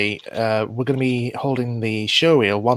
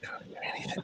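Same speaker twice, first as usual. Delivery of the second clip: breathy whispered complaint; whispering; 19 dB quieter, faster than usual. Real, not acted. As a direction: whisper it to yourself. Headphones dead, can't hear.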